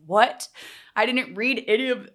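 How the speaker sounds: a sudden loud, high shocked "What?". Retold or imitated. Retelling her shock at the retreat schedule, loud and high. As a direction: blurt it out in disbelief.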